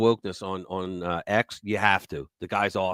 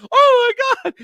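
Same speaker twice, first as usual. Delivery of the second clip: shocked gasp; 10 dB louder than usual. Retold or imitated. Crowd gasp at meeting retold.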